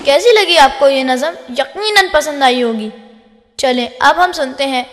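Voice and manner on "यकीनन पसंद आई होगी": ordinary delivery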